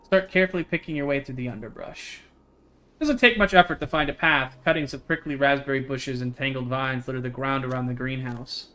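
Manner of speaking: even, measured read-aloud of the story text; game text narration. Acted. Streamer reading game text to chat, steady narration. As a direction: read the scene out steadily to viewers.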